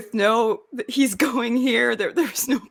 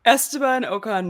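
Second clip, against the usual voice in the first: ordinary talk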